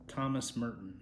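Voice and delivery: ordinary delivery, nothing marked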